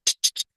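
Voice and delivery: "Ch, ch, ch" as bare voiceless bursts; tongue up, then release each "ch"; a string of short "tch" sounds for "ch". Shown as a demonstration. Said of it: "subimos la lengua aquí arriba".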